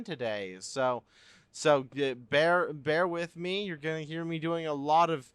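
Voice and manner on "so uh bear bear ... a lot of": ordinary talk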